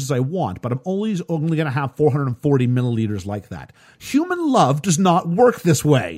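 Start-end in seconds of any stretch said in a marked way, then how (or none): 4.0-6.2 emphatic argument. Real, not acted. Arguing about love.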